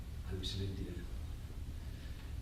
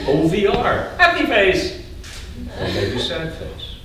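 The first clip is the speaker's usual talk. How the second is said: high, loud singsong; mock text voice; 6 semitones higher, 4 dB louder than usual. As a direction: read it like a silly text. Mocking a text message.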